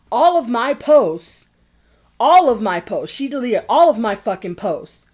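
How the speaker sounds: loud, hammered "all of my posts"; indignant emphasis. Real, not acted. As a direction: hit "all" hard and loud, outraged. Angry repetition about deleted posts.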